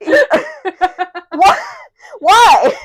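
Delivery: loud, high cry, breaking into laughter; exasperated outcry. Real, not acted. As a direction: wail the question in amused despair. Laughing protest at characterisation.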